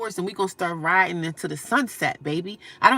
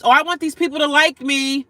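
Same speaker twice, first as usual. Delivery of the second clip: higher, louder mock of someone begging to be liked; mocking quote; 7 semitones higher, 7 dB louder than usual. Mocking people who want acceptance, higher sneering voice. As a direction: sneer it as a needy imitation. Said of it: "trying to be accepted by other races".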